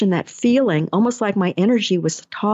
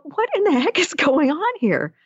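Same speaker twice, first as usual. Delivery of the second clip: higher-pitched, baffled exclamation; 9 semitones higher than usual. Retold or imitated. Recalling her head hitting the car roof, baffled. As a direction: act out puzzled disbelief, pitched up.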